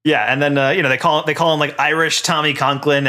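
ordinary talk

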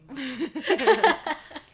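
loud, high burst of laughter. Real, not acted. Laughs at the elbowing sound.

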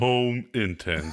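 slow, breathy perfume ad; sultry perfume ad. Pretend. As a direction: purr it like a perfume commercial. Continues mock perfume ad.

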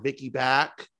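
ordinary delivery

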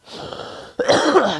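loud, rough hacking cough; a real chesty smoker's cough. Real, not acted. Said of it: "Sorry, smoker's cough".

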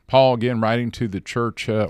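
ordinary talk

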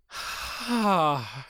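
long, heavy acted sigh; exasperated sigh. Husband fed up with the noise, heavy sigh. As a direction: let out a long exasperated breath.

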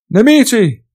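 high, loud alarm cry; shout. Acted. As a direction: bellow the warning. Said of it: "gridò Dieter".

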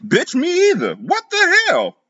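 high, slow disbelief; stunned friend. A narrator's reading. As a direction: gasp it out high.

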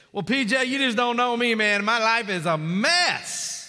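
doubting listener. Acted. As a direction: plead like someone sure they're too far gone.

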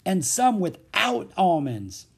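high, loud, drawn-out list item; slow, hilarious holler; gleeful taunt. A narrator's reading. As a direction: crow it slowly with mirth. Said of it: "the laughter beginning again".

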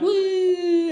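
high, sustained "woo" siren; siren imitation. Comedian imitating sirens passing a nice neighborhood, high wail. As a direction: hold a high wailing note like a siren. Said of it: "they're like".

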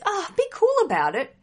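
mock mum brushing it off, high-pitched; high, dismissive impression of a mother; imitated mum. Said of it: "when your mum says".

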